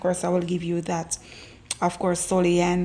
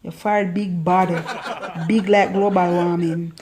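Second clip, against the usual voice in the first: Jamaican patois through giggles; laughing patois tease; 2 semitones lower than usual. Shown as a demonstration. Teasing a friend's forehead in laughing Jamaican patois. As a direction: poke fun in patois, laughing. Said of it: "In Jamaica".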